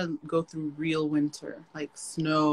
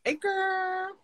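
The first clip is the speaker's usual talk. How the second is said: excited greeting; 12 semitones higher than usual. Real, not acted. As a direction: squeal a happy hello. Greeting Pauline in a high voice.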